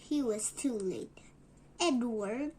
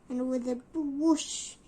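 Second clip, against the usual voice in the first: loud whoosh; 8 dB louder than usual. A narrator's reading. Reading a fast exit.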